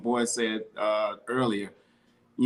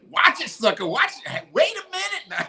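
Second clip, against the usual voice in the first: high-pitched aunt's warning, played for laughs; mock scolding in a much higher voice; checking aunt. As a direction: imitate an aunt about to check someone. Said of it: "right before she check you, she gonna be like".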